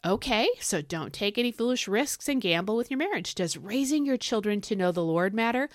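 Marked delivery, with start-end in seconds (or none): none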